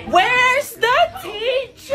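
high, slow screaming cry; panicked cry. Acted. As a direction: shriek for help. Frightened cry during fight.

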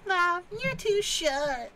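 high, slow, loud mocking girl. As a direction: sneer it in a high squeal. Acting girl rejecting.

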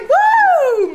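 high, loud mock cheer for the sim; voiced cheer. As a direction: whoop like she just won. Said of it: "She's like".

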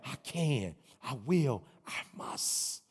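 soft, dropping chant, last word breathed; repeat quietly. Shown as a demonstration. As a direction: lay it down softly, word by word. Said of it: "I need you to repeat that".

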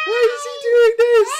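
high, loud mock-child wail; imitating confused son. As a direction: squeal it like a baffled kid. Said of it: "He's like".

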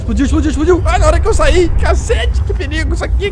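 high loud exclaiming; alarmed excitement. Real, not acted. Player barely escaping an eruption, high loud exclamations. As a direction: burst out as it erupts. Volcano erupts right after leaving.

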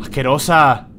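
loud disgusted insult; raging gamer. Real, not acted. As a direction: spit out the insult loudly. Insults the enemy witch.

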